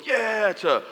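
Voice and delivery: high cheer that falls away; crowd cheering. Retold or imitated. Twenty thousand people cheering as he walks out, imitated cheer. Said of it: "twenty thousand people go from".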